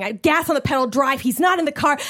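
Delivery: normal talking voice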